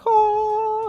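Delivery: a sudden high squeak of surprise; surprised oh. Retold or imitated. Spotting the light under the door, squeaking in surprise. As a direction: jump way up on the oh.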